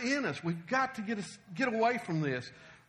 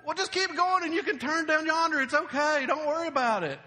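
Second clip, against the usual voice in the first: high, loud, folksy reassurance; acted down-home direction-giver; easygoing local guide; 7 semitones higher, 5 dB louder than usual. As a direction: wave them on like a local giving directions. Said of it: "I want to say".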